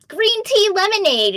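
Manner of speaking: high, loud playful example name; playful mock announcement; mock quirky code name. Mimics a made-up code name.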